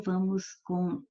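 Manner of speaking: ordinary delivery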